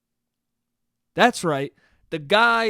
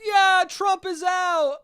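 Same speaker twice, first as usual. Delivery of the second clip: high, slow mock cheer; drawn-out, high-pitched mock celebration; cheering crowd; 13 semitones higher, 4 dB louder, slower than usual. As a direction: sing it out like a happy crowd. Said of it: "Everyone's gonna be like".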